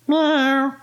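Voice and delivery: high, drawn-out cat voice; cat's meow. A narrator's reading. Wilbur the cat in the rocket, high meow.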